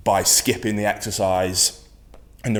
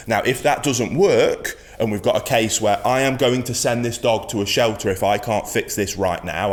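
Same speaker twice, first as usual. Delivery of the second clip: normal talking voice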